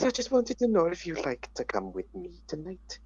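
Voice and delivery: high-pitched Scouse attempt sliding into Welsh; Scouse accent gone Welsh. Shown as a demonstration. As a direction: try Scouse, let it come out Welsh. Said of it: "that was more Welsh than I was intending".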